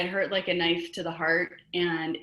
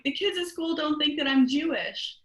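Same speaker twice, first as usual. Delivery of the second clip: high-pitched imitation of a tearful kid; upset crying child; 8 semitones higher than usual. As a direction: plead like an upset little girl. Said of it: "I was crying in my room".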